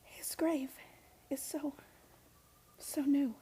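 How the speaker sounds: hushed whisper at the grave. Real, not acted. Girl at her grandfather's fresh grave, whispering. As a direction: breathe it, barely voiced.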